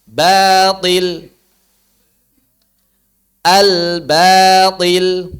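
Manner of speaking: Al-batil with a thin, open a, slow; loud slow Al-batil, vowels kept open; correct thin batil. Shown as a demonstration. Teacher models the right reading of batil. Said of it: "Jangan dibaca".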